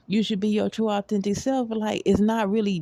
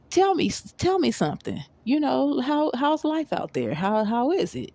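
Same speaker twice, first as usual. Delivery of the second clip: eager curiosity; 7 semitones higher than usual. Retold or imitated. Acting out eager questions to new acquaintance.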